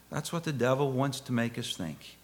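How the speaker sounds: ordinary delivery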